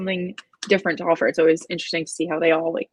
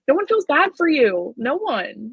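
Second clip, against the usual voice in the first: mock scolding; 7 semitones higher than usual. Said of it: "I'm like".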